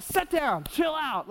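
put-on high, sharp shout to settle a child; mock yell. Preacher acting out yelling at a restless child, mock yell. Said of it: "you just wanna yell".

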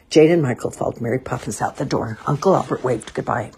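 usual speaking voice

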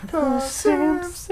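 silly voice. Pretend. Echoed with put-on voice.

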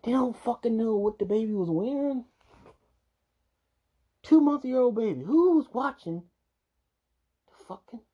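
high, loud disbelief; outraged. Real, not acted. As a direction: yell it in outrage. Outraged reacting to news.

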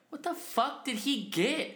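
high, drawn-out disbelief; baffled. Retold or imitated. Friend watching him come out with a bag, baffled.

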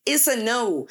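emphatic closing refusal; firm no. Real, not acted. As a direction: plant your feet on no. Firm stance on boundaries.

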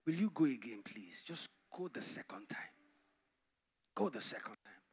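soft pleading that sinks into a whisper; begging softly. Acted. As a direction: beg him gently, almost whispering. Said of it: "to beg him again".

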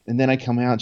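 usual speaking voice

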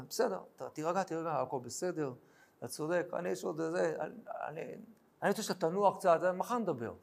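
soft, hushed calming of an angry man; mild king soothing a soldier in a hushed voice. Acted. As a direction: settle him down quietly, almost under the breath. David soothing angry Uriah.

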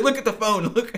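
exasperated, laughing insistence; flustered urging. Retold or imitated. Boyfriend kneeling to propose urges her to look away, flustered urging.

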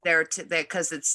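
ordinary delivery

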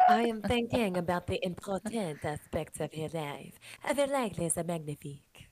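put-on lightly done French accent; a hint of French accent, slow. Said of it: "I can do a French accent".

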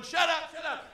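high mock yell, not full volume; high, held-back scream; retold scream. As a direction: imitate screaming at brawlers. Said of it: "I'm screaming at these people".

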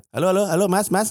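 high-pitched pretend phone hellos; faked bad connection. Comedian dodging Mas Anies on the phone, faked bad connection. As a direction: call out as if the line is dropping. Acts out pretending to lose signal.

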